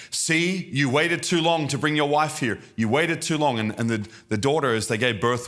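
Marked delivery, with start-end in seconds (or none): 0.1-3.6 high, taunting accusation; accusing nurse. Retold or imitated. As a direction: voice the possessed nurse mocking him. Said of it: "she starts saying".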